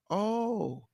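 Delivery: usual speaking voice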